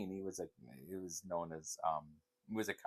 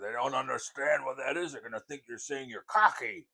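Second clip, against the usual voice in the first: imitating an irritated grandfather, higher and louder; a retold gripe, pitched up and pushed; grandpa's mad voice; 6 semitones higher, 6 dB louder than usual. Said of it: "would get so mad".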